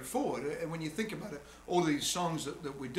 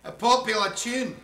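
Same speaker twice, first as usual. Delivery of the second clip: louder, higher Scottish burst; Scottish accent; 5 semitones higher, 6 dB louder than usual. Real, not acted. Singer repeating himself as accent slips, louder Scottish accent. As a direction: let the Scots accent slip out, drawn out. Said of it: "My Scottish has happened".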